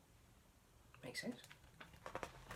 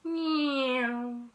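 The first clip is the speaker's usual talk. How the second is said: high, loud playful meow; child's loud meow; 7 semitones higher, 11 dB louder than usual. Acted. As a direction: cry it out like a toy plane. Baby Bear playing aeroplane.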